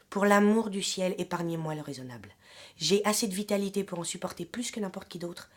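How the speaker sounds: vehement letter reading, loud attacks easing at phrase ends; impassioned literary reading. Acted. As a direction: declaim the love letter with rising fervour, then ease off. (lecture passionnée d'une lettre d'amour)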